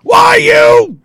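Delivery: hammed-up Scottish shout; Scottish accent. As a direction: bark it as the furious Scotsman. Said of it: "you're gonna do the imitation".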